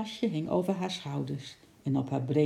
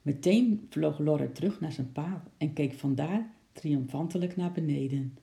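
ordinary delivery, nothing marked

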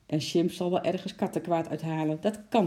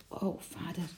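put-on much softer, hushed child's voice; soft whisper; 8 dB quieter than usual. A narrator's reading. Lotje whispers to her father, soft and moved. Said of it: "fluisterde Lotje zacht".

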